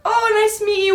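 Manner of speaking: lightly voiced polite child; imitating her younger self. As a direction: play it sweet and innocent. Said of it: "And I'm like".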